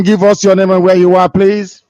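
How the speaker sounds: normal talking voice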